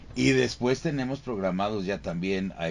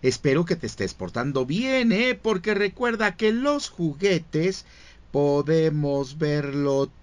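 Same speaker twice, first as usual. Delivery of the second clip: high, friendly cowboy sheriff toy; slow and spooky on the warning; Woody voice; 6 semitones higher than usual. Acted. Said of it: "con la voz de Woody".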